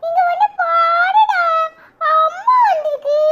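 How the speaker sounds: loud, drawn-out call across the house; excited call to the brother. Acted. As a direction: shout for your brother to come see. Child calls brother to come.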